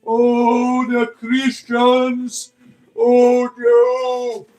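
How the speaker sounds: high, loud, wailing mock demon cry. Streamer mocking exorcists as a whimpering demon, high and hammed-up. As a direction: ham up a fleeing demon, shrieking in panic.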